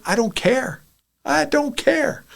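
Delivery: ordinary delivery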